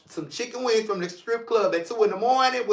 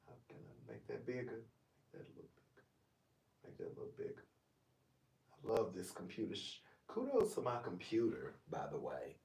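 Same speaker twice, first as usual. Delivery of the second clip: much softer muttering to himself at the computer; off-mic muttering; 8 semitones lower, 21 dB quieter, faster than usual. Real, not acted. Host fiddling with his computer, muttering aside very softly.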